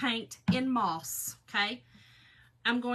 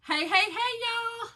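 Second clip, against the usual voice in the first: live greeting; 13 semitones higher, 6 dB louder, slower than usual. Real, not acted. Greeting viewers going live.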